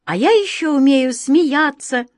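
put-on a small girl bursting with joy; happy little girl. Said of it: "не умолкала Глазастик".

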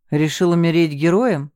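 mildly sarcastic jibe. A narrator's reading. Man taunts his rival about dying a hero, sarcastically. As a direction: needle him with understated sarcasm. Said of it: "съязвил Тимур".

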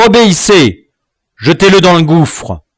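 high, loud command; powerful voice. A narrator's reading. Dwarf ordering men to kill captive, powerful command. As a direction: order them forcefully to obey. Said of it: "À la voix puissante".

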